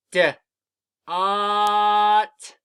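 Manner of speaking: short "D", pause, then long loud "ot"; sounding out. Shown as a demonstration. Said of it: "Say the sounds with me".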